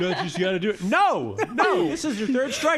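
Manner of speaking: high, loud, laughing banter; laughing mock bro. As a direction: tease Dave through laughter. Frat bros pressuring Dave.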